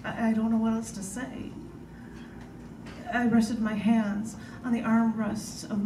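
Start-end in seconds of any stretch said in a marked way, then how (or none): none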